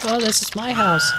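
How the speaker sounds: high mock-confused voice; high dazed woman. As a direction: say it like waking in a strange place.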